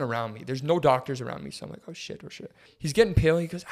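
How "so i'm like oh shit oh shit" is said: whispered under the breath in panic; panicked whisper. Retold or imitated. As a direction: mutter it to yourself in alarm.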